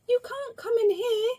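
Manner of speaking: high, loud alarmed cry; a little girl crying out to stop the intruder. A narrator's reading. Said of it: "cried Little Miss Sunshine".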